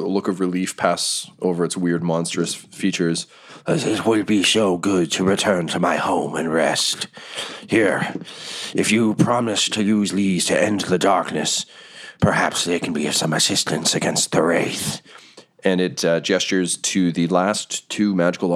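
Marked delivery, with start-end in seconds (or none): 3.7-15.0 strange monster voice, weary and sincere; relieved, earnest creature. Acted.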